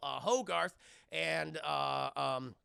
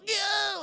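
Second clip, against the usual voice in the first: high, loud mock scream; falling kid's cry; 9 dB louder than usual. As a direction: yelp like a kid tumbling over. Kids falling, retold.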